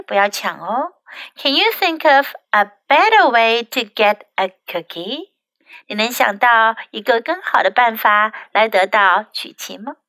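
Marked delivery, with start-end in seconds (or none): none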